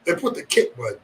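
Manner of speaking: ordinary talk, nothing marked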